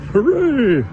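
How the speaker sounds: loud, high hooray; a burst of glee; cheer. Real, not acted. Cheer inside her song.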